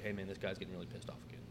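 whispered, quick server's voice; imitated hushed warning. Server comes up to warn manager about guest, imitated hushed warning. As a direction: pass it on under your breath, quickly.

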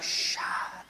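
sharp voiceless shushing; whispered hushing. Retold or imitated. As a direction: hush the kids before the preacher hears. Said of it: "she'd be like".

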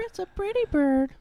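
high, soft parrot squawk. Retold or imitated. Parrot voice from Dumb and Dumber.

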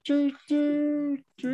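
high, slow, put-on voice; silly punchline voice. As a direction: stretch the punchline out comically. Punchline of teacher joke.

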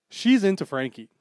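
loud teasing gossip; excited gossip. Real, not acted. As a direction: blurt it out gleefully.

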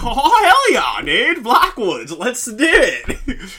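high, loud whooping stoner dude; hyped-up stoner holler, drawn out at the end; stoner surfer. Retold or imitated. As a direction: shout it like a thrilled surfer stoner. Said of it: "I was trying to do a stoner accent".

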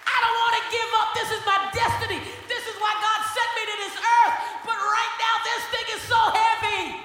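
Jesus begging his disciples to stay awake, anguished shout; Jesus pleading. Acted. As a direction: cry out to sleeping friends, desperate. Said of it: "That's why Jesus got mad, 'cause he's like".

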